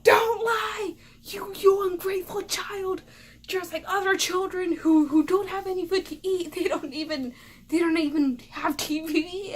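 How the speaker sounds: high, loud, outraged mom rant; mock-hysterical parental scolding; yelling mother. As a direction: imitate a mom shrieking a guilt trip.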